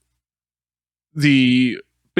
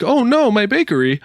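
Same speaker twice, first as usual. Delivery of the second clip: wailing baker; 9 semitones higher than usual. Pretend. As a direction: cry out over the ruined bakery. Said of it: "he's like".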